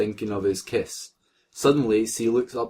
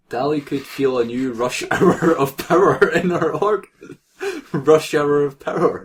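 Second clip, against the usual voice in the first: laughing while reading; 5 semitones higher, 6 dB louder than usual. Real, not acted. Reading a silly line, laughing.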